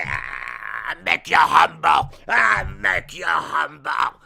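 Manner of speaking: loud, slow acted bellow; roaring wrestler threat. Veer attacking Dominic, loud acted roar. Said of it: "Like this, like".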